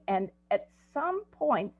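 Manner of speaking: ordinary talk, nothing marked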